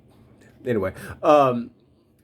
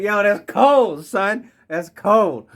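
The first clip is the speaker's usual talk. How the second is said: higher, louder, slow mock indignation; mock outrage; 10 semitones higher, 5 dB louder, much slower than usual. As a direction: drag it out, amused and offended. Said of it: "I'm like".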